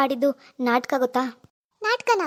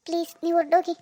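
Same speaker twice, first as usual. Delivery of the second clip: usual speaking voice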